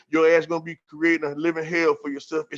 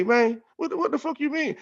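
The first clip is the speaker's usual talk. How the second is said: sharply higher, disbelieving outburst; incredulous; 11 semitones higher than usual. Retold or imitated. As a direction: throw it back in shock, voice jumping up. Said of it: "I say".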